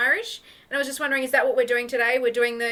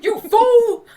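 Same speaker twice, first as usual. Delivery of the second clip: high, loud bellow through laughter; shouted catchphrase impression with a laugh; Mister T; 11 semitones higher, 14 dB louder than usual. Improviser jokes as the TV character, mock-gruff bellow. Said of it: "Okay, Mister T".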